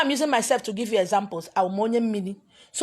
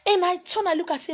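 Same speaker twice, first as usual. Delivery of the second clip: raised voice; 9 semitones higher than usual. Real, not acted. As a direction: pitch jumps high as she turns. High pitched start of retort.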